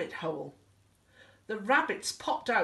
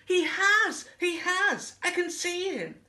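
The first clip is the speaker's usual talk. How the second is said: high, excited outburst; urgent high-pitched alarm; alarmed elephant; 12 semitones higher, 5 dB louder than usual. Acted. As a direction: blurt it high, starting loud. Elephant alarmed, thinks he sees Wilbur.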